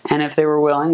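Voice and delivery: ordinary talk, nothing marked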